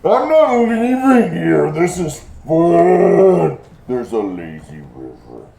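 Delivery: put-on high, slow stubborn child; ghost child voice. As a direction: pout like a spoiled kid.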